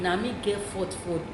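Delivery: usual speaking voice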